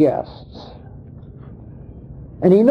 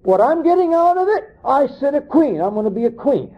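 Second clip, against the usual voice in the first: high-pitched mock bride's boast; self-serving bride; 11 semitones higher, faster than usual. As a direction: play a bride claiming her crown, pitched well up. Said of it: "and the bride would say".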